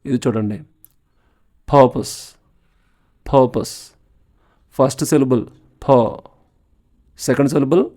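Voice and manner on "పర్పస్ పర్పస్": Purpose with the first syllable పర్ stressed and the second పస్ weak. Shown as a demonstration. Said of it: "first సిలబుల్ని stress చేసి పలకాలి".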